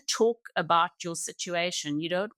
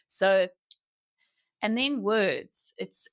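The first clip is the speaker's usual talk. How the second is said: ordinary delivery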